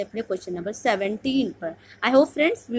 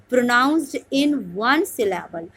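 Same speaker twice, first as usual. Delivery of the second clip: slow dictation reading; much slower than usual. Shown as a demonstration. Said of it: "teacher reads out exam question".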